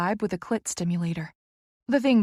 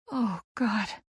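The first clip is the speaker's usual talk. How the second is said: whimpering; 3 semitones higher, 3 dB quieter than usual. A narrator's reading. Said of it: "I whimper".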